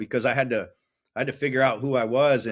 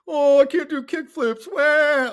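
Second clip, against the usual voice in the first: much higher, louder crybaby voice; mock whining; 14 semitones higher, 6 dB louder, slower than usual. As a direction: mimic a whiny kid to tease him. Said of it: "They'd be like".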